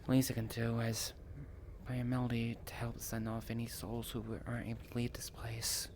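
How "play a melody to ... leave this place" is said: ordinary talk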